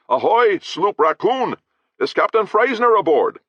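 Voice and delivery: loud, high hail across the water; calling out. Acted. Captain hails a ship's rail, calling out loud. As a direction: hands cupped, hail the other ship. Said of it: "and called".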